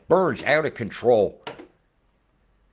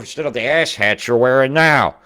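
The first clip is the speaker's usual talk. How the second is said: mocking shout; 5 semitones higher, 11 dB louder than usual. Real, not acted. Mocking rant at the end.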